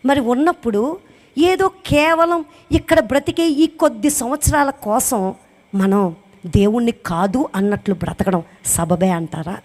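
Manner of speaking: ordinary delivery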